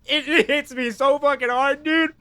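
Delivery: high, emotional voice close to tears; overwhelmed, half laughing, half crying; choked up. Real, not acted. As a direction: voice cracking with a laugh and a sob. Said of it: "I just start fucking crying".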